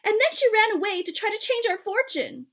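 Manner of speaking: sputtered. A narrator's reading. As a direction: blurt it out, upset and accusing. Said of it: "Ma sputtered".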